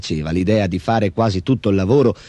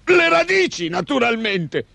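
high, huffy ogre voice; irritated outburst; 10 semitones higher than usual. A narrator's reading. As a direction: burst out high-pitched, as a cross creature. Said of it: "sbottò e se ne andò". (orco stizzito, voce acuta)

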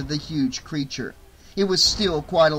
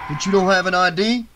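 loud, fast suspicious doorman; doubting the ID; gruff bouncer; 4 semitones higher, 6 dB louder, faster than usual. Acted.

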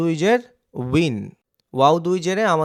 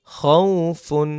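slow blended reading; much slower than usual. Shown as a demonstration. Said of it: "teacher slows the joined word".

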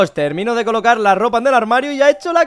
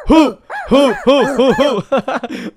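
loud ju ju laugh; 5 semitones higher, 6 dB louder, much slower than usual. Real, not acted. Laughing at the joke.

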